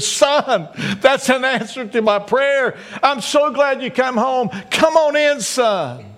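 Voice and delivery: a father weeping with joy as his son comes home; tearful father. Acted. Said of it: "with tears in his eyes".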